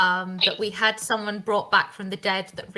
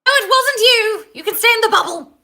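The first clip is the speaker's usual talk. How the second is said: loud, high shout; 13 semitones higher, 11 dB louder than usual. Real, not acted. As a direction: call out across the table. GM shouting to the bubble.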